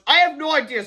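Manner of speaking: high, loud exclamation; agitated reply. A narrator's reading. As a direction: answer urgently mid-chase. Answer during chase.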